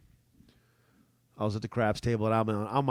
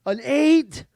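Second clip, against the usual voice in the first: calling the dice; 8 semitones higher, 5 dB louder, slower than usual. Retold or imitated.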